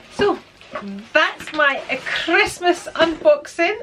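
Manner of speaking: usual speaking voice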